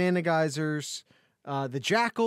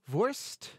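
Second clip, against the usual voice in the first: a playful German Vorst; lightly hammed German Vorst, asking; German accent; 5 semitones higher, 4 dB quieter than usual. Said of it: "trying to make it extra German".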